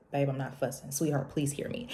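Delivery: hushed loving partner; gentle reassurance. Retold or imitated. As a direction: soothe her calmly. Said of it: "he'll keep saying".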